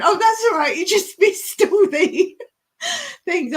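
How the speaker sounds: high, loud, giggly; real amused outburst; laughing while talking. Real, not acted. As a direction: tell it through bubbling laughter. Laughing at what was missed.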